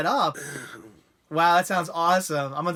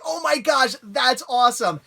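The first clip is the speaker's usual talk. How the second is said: excited; 7 semitones higher, 5 dB louder, slower than usual. Retold or imitated. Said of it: "I'm like".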